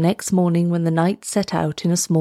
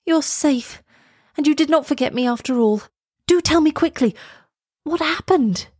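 put-on high, eager girl's voice; high-pitched relief turning to urgent questioning; Elsa, excited and relieved; 9 semitones higher than usual. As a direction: press a returned friend for news.

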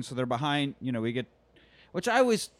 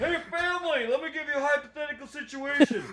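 stuck dad voice; 10 semitones higher, 4 dB louder than usual. Retold or imitated. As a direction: play a trapped dad calmly pitching a hypothetical. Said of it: "he's like".

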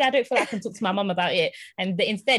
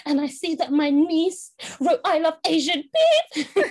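higher-pitched, hammed-up tearful wail; mock crying; 8 semitones higher, 4 dB louder than usual. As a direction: imitate a distraught auntie crying down the phone. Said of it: "like, crying".

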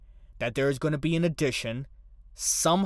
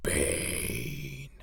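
Bane mask voice; 5 dB quieter than usual. Shown as a demonstration. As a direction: say it like through a mask, quieter. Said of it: "try to do it with the mask voice".

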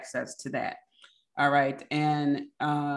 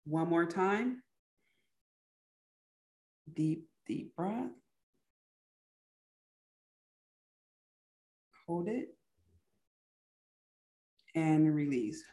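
guided deep breathing; 5 dB quieter than usual. Real, not acted. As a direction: lead the breaths gently and quietly. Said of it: "let's take a deep breath".

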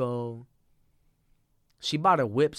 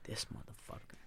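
muttered curse; 16 dB quieter than usual. Retold or imitated. Muttering at the man who locked himself in.

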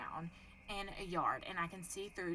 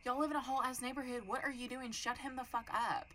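higher, incredulous telling-off; exasperated scolding; 5 semitones higher, 6 dB louder than usual. Retold or imitated. Retold outrage at people letting him go off, exasperated. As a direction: scold them in disbelief, pitch up.

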